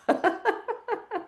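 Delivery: long, high forced laughter; deliberate high cackling laugh; high exercise laugh. Shown as a demonstration. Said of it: "Clean it with laughing".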